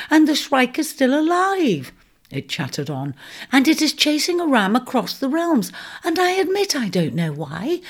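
high, eager little mouse voice rattling off gossip; a breathless gossipy mouse spilling news; chattering mouse. Acted.

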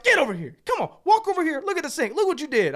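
high, loud angry orders; so mad. Retold or imitated. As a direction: order her over, re-enacting the fight. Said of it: "I'm so mad".

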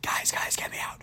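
soft, voiceless imitation of screaming; whispered mock scream. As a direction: whisper it like a muffled cry for help. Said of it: "He's, like, screaming".

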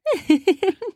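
high light giggle; girlish giggle. A narrator's reading. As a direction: giggle playfully. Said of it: "Michelle just giggled".

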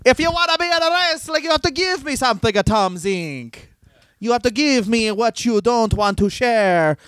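high-pitched cartoon foreign accent; Pat Patterson. Wrestler making demands of a newcomer, in a high comic accent. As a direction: start high and quick, then stretch it out. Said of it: "a Pat Patterson".

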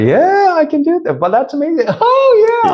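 very high, melodic excited voice; exuberant high range. Shown as a demonstration. Coach showing he does have range, high and exuberant. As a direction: leap way up high and sing it out.

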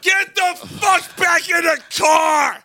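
high, slow, full-volume mock scream; retold yelling. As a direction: bellow an order as the furious woman. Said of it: "She yelled at me".

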